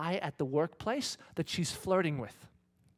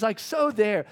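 ordinary talk

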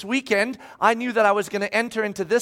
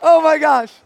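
high, loud groan of disbelief; exasperated exclaim; 6 semitones higher, 8 dB louder than usual. Retold or imitated. Pastor reacting to his wife's answer, loud and exasperated. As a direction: throw up your hands. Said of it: "I'm like".

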